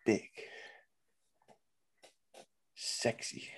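slow, low, hushed build-up; hype intro. Pretend. Introducing his co-host, low hushed announcer tease. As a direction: drawl out the co-host's name like an announcer tease.

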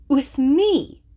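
high, loud astonished question; utmost amazement. A narrator's reading. Eleanor astonished Willoughby wants her, amazed. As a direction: caught completely off guard. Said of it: "in the utmost amazement".

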